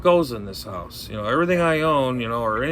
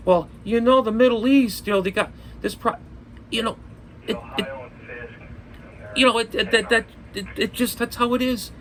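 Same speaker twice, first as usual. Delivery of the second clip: much higher, halting know-it-all mimicry, stumbling over every word; mocking Rick Wells; 8 semitones higher, faster than usual. Vlogger mocking the preacher he can't stand, high stammering mimicry. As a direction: pitch it up high and stammer like a self-important preacher. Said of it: "he's gotta have emphasis in every sentence".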